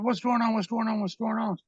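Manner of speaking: rapid-fire repeats, mildly alarmed; urgent retold questioning. Calling to screaming friend behind bathroom door, urgent.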